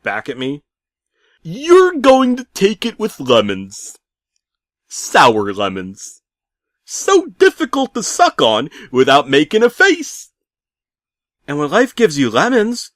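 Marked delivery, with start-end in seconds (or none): 1.4-10.3 high, loud, slow grotesque cheer; creepy cheery voice. Acted. Pizza man pushing lemons on the narrator, high and loud. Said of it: "strangely cheery but horrifically featured gentleman". 11.5-12.9 high, loud proverb voice; mock solemn. Narrator answering the pizza man, loud and high. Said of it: "I started".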